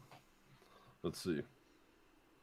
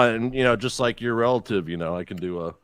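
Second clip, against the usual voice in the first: ordinary delivery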